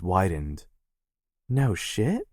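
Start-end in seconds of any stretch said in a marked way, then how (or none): 1.5-2.3 higher, rising disbelief; surprised. Acted. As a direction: ask it wide-eyed. Sister surprised by news.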